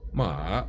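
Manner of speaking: "ma" dipping low, then rising; "ma" dropped down and curved back up; third tone. Shown as a demonstration. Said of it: "Third tone".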